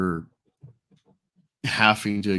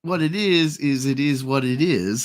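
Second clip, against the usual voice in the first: higher-pitched mimicry of a catchphrase talker; impression of Ripa; 5 semitones higher than usual. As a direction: imitate his pet phrase, pitched up. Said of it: "that's a good impression of Ripa".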